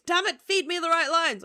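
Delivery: loud, high fake diva demand; play-acted angry yell, clearly joking; mock outburst. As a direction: shout it in pretend outrage. Mock diva outburst, then "I'm kidding.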